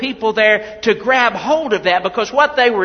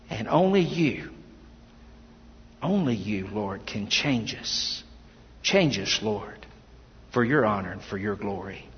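hushed prayer; 8 semitones lower, 7 dB quieter than usual. Acted. Pastor praying with the congregation before the sermon, hushed and reverent. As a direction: pray quietly over the room.

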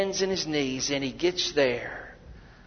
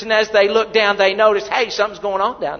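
loud, higher, driving sermon delivery; preaching at full force; 6 semitones higher, 7 dB louder than usual. Acted. Preacher telling the congregation of the battle, loud and driving. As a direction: build the fire in the pulpit.

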